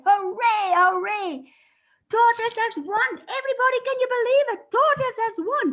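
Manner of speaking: high, loud jubilant cheer; crowd cheering. Acted. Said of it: "everybody shouted and said".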